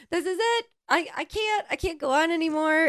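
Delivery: high, wailing mock breakdown; mock despair. Replaying a voice message to her friend, mock despairing wail. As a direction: act like you're at the end of your rope. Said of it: "I just sent you a voice message like".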